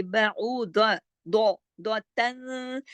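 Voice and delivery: द्वा and दो said thick and full, तन humming long; stretched syllable-by-syllable demo of दो तन; heavy dad. Shown as a demonstration. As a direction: slowly drill बाऊ द्वा with a heavy, rounded d. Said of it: "द्वाद को आप मोटा ही पढ़ेंगे".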